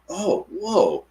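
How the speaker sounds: high-pitched, awestruck gasp of words; imitated amazement. Ladies looking up at strange lights, mock amazed. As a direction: mimic onlookers amazed, voice leaping high.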